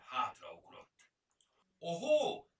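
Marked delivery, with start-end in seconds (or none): 1.8-2.5 a much higher, loud startled cry; startled exclamation. A narrator's reading. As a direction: jump back in surprise and shout it out. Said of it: "kiáltott fel". (meglepett, magas, hangos felkiáltás)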